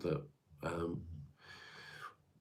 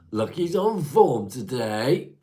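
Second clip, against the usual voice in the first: higher, louder, slow drawn-out proclamation; playful sing-song repeat; 7 semitones higher, 11 dB louder, slower than usual. Acted. Streamer teases a chat regular with a slow, loud, high repeat. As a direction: stretch it out grandly to tease.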